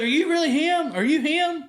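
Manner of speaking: put-on an eight-year-old asking if he is really the famous one, high-pitched; kid voice. Child asking if it's him.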